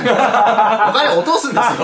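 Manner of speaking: high, loud, slow plea through laughter; loud, drawn-out comic protest; laughing insistence. Real, not acted. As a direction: hammer it home while laughing. Laughing, insisting on spending money.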